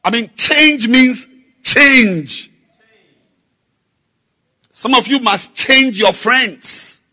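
slow, loud, hammering sermon delivery; preaching at full force. Acted. Preacher urging the church to change, full force. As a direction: drive every word home to the congregation.

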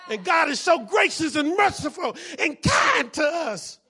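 loud, high, shouted preaching; preacher rising to a shouting climax; preaching at full force. Acted. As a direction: drive it home to the congregation.

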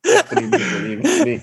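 high, laughing through the words. Real, not acted. Comedian explaining to hosts it was inner talk, laughing. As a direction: crack up while explaining.

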